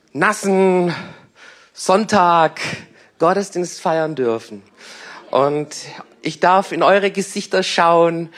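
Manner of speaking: ordinary delivery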